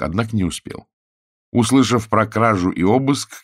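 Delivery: ordinary delivery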